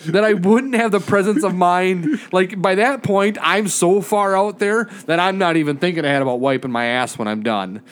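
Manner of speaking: ordinary delivery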